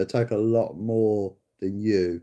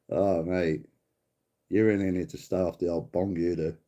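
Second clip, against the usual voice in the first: ordinary talk, nothing marked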